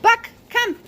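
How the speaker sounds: very high call to the dog far off. Real, not acted. Trainer calling Buck in from the yard, high ringing call. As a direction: sing out his name high.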